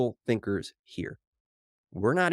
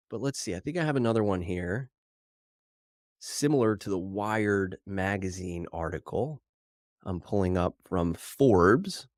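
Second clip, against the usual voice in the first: ordinary talk, nothing marked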